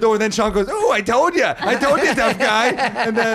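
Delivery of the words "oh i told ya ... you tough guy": mock New York. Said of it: "The New York came out".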